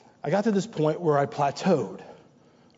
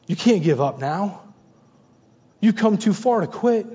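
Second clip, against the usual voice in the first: full-force preaching; 3 semitones higher, 5 dB louder than usual. Acted. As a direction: build the exhortation, pressing hard.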